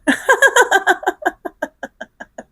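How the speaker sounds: high, rattling laugh that dies away; a long, real laugh, pitched high and fading. Real, not acted. Laughing at a question about forbidden things, high laugh.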